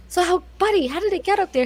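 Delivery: lightly voiced kindly doctor; friendly doctor. Doctor gently questions the child.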